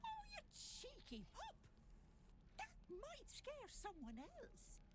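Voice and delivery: high, swooping mock outrage; delighted, high-pitched fussing over a cheeky pup; playful scolding. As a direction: coo a teasing telling-off way up high. Teasing a cheeky customer playfully.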